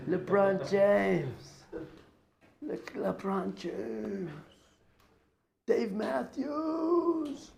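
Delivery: slow, drawn-out, dreamy celebrity names; mock swooning. Mocking fans who would pick celebrities, in a drawn-out swoon. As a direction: sigh out the names like a starstruck fan.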